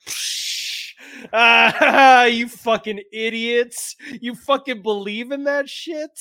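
high, loud, laughing sneer at fools; scornful mockery. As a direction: jeer at their belief through laughter. Han mocking believers.

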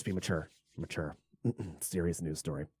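very low, hushed mutter; quiet aside to oneself; muttered self-reminder. Real, not acted. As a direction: coach yourself under your breath. Muttering self-reminder to stay serious.

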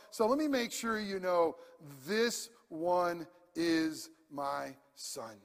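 ordinary delivery

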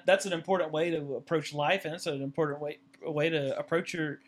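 ordinary talk, nothing marked

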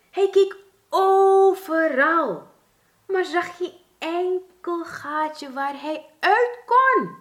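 high, slow, frightened squeak over the narration; hoog, traag en angstig piepend muisje; frightened little mouse. A narrator's reading.

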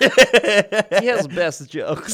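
put-on slow, loud Russian accent. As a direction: drag it out in a thick Russian accent.

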